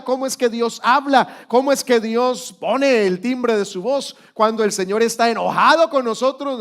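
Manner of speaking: loud, high, fervent preaching; preaching at full force. Acted. Pastor urging the congregation, loud fervent preaching.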